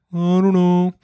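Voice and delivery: put-on high, sulky little-kid voice; pouty child. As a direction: imitate a pouting daughter. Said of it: "getting all pouty".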